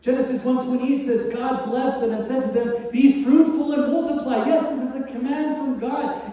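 loud, high, fervent preaching; preaching at full force. Acted. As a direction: proclaim it to the whole church. Opening scripture at full force.

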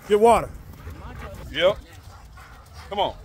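normal talking voice

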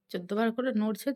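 ordinary talk

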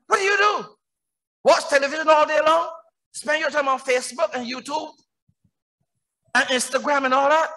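high-pitched sermon questioning; challenging preaching. Real, not acted. Preacher confronting members about wasting their days, challenging preaching. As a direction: fire pointed questions from the pulpit.

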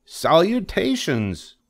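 high, slow, eager pig greeting; delighted Wilbur. Acted. Wilbur echoes Charlotte's fancy greeting eagerly.

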